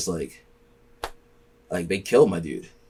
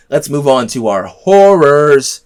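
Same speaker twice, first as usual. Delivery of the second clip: slow, louder announcer read; segment announcement; 3 semitones higher, 7 dB louder, slower than usual. Acted.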